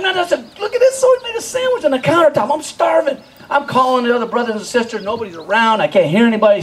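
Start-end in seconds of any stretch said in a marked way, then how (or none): 0.0-3.2 hungry excitement. Retold or imitated. Hungry kid finds sandwich.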